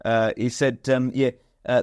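ordinary talk

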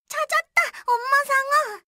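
high, loud joyful cry; excited. Acted. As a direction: shout with joy at finding her. Finding mother.

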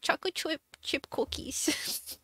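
put-on high, slow cutesy baby-talk; breathy slowed-down uwu cookie talk. Shown as a demonstration. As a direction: coo it like a cutesy anime voice. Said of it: "I'm obsessed with just saying".

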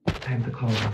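ordinary talk, nothing marked

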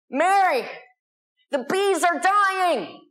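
high, drawn-out alarmist cry; all-caps alarm. Acted. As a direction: read it like a screaming subject line. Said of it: "All caps, exclamation point".

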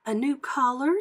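high, surprised question; questioning woman. A narrator's reading. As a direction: ask it high, working out the answer. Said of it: "she said".

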